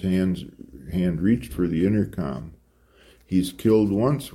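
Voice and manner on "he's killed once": ordinary delivery, nothing marked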